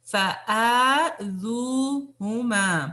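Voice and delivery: فَآذُوهُمَا with long drawn vowels, very slow; stretched madd. Shown as a demonstration. Teacher to class: very slow stretched recitation. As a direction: draw out every madd in فَآذُوهُمَا. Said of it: "جتنے بھی مدسی ہیں".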